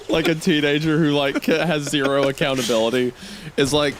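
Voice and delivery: ordinary delivery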